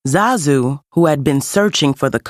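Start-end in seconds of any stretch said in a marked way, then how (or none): none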